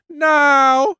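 put-on very high girlish no; innocent daughter. Persephone denying it in a squeaky high voice. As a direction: answer sweetly. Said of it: "she's like".

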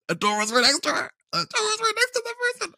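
fast and climbing very high, breathless; excited rush. Retold or imitated. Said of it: "my sister was just like".

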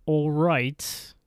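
mock a bad British accent; the suits fake a bad British accent; terrible British accent. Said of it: "exactly like that, where we can all tell that's from England".

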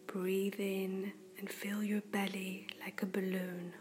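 ordinary talk, nothing marked

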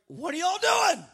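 loud, high demand to idle brothers; acted indignant outburst; exasperated sister. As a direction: call out, fed up with your brothers. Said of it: "she's like".

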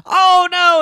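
high, loud groan of dismay. A narrator's reading. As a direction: react to the cake in dismay. Dismay at frosting.

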